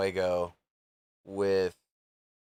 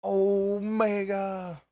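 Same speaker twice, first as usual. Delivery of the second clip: announcer call; 9 semitones higher, much slower than usual. Pretend. Announcing wrestler.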